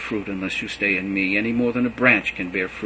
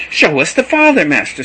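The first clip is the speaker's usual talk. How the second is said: much higher and louder plea; eager demand; 6 semitones higher, 10 dB louder than usual. A narrator's reading. Philip demanding to see the Father, high and loud. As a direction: insist on seeing the Father.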